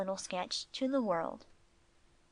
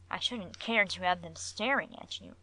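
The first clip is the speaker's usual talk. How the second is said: ordinary delivery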